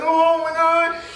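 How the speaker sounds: high-pitched "oh my God", overwhelmed; amazed gasp. Retold or imitated. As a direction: retell the moment you were floored. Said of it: "I was just like".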